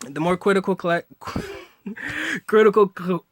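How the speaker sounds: stumbling over words with a laugh; tongue-tied. Real, not acted. Tutor flubbing the critical question, tongue-tied and laughing. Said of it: "I don't know how to speak today".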